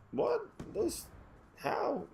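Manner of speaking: small, high-pitched, soft disbelief; baffled. Retold or imitated. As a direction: ask it high and quiet, lost for words. Baffled at seeing cop lights.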